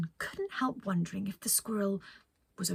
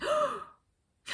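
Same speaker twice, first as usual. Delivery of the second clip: high delighted gasp. Acted. Penelope spots a squirrel.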